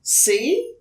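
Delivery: mother comforting her scared son; reassuring mother. Acted. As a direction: soothe the frightened child. Mother reassures Franklin at night.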